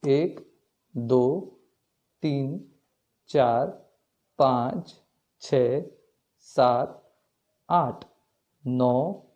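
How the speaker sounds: slow, even beat count, one number per pulse. Shown as a demonstration. Teacher counting taal beats aloud for students, slow and even. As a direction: tick off each beat steadily.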